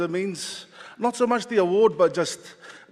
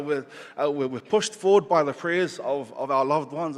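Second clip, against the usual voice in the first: ordinary delivery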